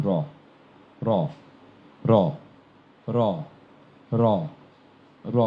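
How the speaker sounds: crisp র tapped on the gum ridge, one at a time; র demo. Shown as a demonstration. Teacher drilling র for the class. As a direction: tap র lightly behind the top teeth. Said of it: "র উচ্চারিত হয়".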